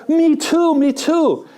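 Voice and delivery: high, eager agreement; eager me too. Retold or imitated. Preacher telling a joke, eagerly agreeing with the jumper. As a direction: chime in eagerly, delighted.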